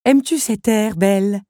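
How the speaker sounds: put-on a cruel musician taunting the girl; villain Henri. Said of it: "demanda le personnage".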